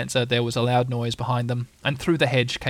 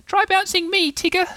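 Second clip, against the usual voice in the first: very high, squeaky excitement; piping, thrilled little animal; eager; 4 dB louder than usual. A narrator's reading. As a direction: beg for a turn in falsetto. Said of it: "said Roo eagerly".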